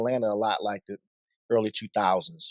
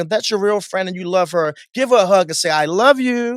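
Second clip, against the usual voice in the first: higher, preacherly call to the crowd; imitating a pastor-style party host; 8 semitones higher, 4 dB louder than usual. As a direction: urge them like a congregation. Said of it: "like you was a pastor".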